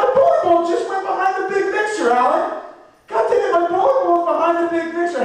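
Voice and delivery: very high, fast, exasperated whining at Alan; a flustered inventor squealing complaints at his helper; shrill panicked falsetto rant about the mess; frantic vacuum inventor. Acted. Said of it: "this is my impression of the guy who invented that vacuum cleaner".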